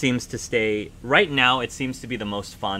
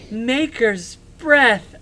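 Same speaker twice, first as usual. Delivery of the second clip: put-on high, slow, breathless child; awed child voice; 10 semitones higher, 6 dB louder, much slower than usual. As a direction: draw it out in wide-eyed wonder. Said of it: "He says".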